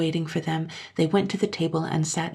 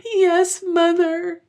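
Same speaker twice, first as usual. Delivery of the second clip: high, louder bitter sobbing; sobbing bitterly; 14 semitones higher, 6 dB louder, much slower than usual. Acted. Child answering mother, sobbing bitterly and high. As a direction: sob it out. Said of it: "the child sobbed more and more bitterly".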